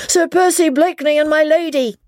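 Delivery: very high, full-voiced announcing cry; excited shout. A narrator's reading. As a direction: call it out to the whole inn. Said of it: "shouted at the top of his voice".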